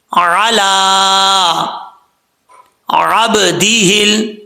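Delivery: very slow, drawn-out recitation; slow recitation demo. Shown as a demonstration. Teacher modelling the reading for students. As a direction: recite each word slowly and clearly. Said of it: "تو اس کو کیسے پڑھیں گے؟".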